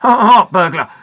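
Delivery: loud, blustering surprise; baffled, forceful question; astonished. A narrator's reading. Grandpa questioning the constable's story, astonished.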